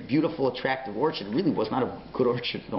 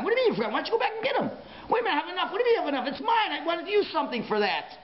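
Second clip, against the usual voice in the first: higher, excited back-and-forth between two voices, with laughter; acted argument; faster than usual. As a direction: play both sides of a quarrel, pitched up. Acted argument between God and Yaakov.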